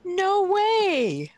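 real high-pitched disbelief; astonished. Real, not acted. Host learns the guest's real age, astonished. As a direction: hold it high in amazement.

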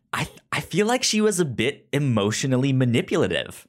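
ordinary talk

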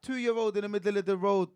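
normal talking voice

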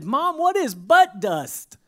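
put-on loud, slow, high child's question; girl calling loudly to her mom; loud little girl. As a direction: play a six-year-old blurting it out in church. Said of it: "in a very loud voice said".

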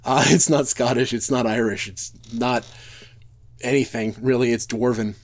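a light made-up Dwarven brogue, half laughing. Real, not acted. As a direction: slip into his fantasy Dwarven brogue. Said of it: "pardon my terrible brogue".

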